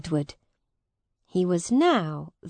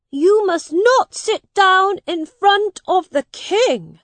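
put-on high, firm young prince's voice; a boy king correcting his companion; boy king Edward; 12 semitones higher, 9 dB louder than usual. A narrator's reading. Edward tells Miles not to sit, high and firm.

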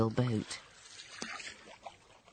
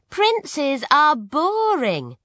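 much higher, slow, loud whiny princess; bored princess; 12 semitones higher, 6 dB louder, much slower than usual. Acted. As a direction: drag it out as a spoilt princess. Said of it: "she said".

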